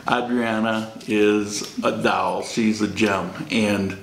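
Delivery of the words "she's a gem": normal talking voice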